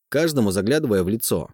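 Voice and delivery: ordinary delivery, nothing marked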